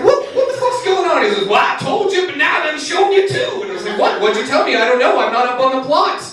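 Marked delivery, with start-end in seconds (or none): 0.0-1.2 high startled yell, much higher; shocked shout. Retold or imitated. Startled shout at homeless man. 1.5-3.6 much higher, shouted reply; hammed-up homeless man explaining; mock-indignant homeless man voice. 4.0-6.2 high confused. Retold or imitated. Confused retort.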